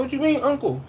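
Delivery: high mock-offended question; high put-on voice, offended. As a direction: play it up as the offended elder. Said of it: "I'm like".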